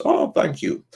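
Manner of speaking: ordinary talk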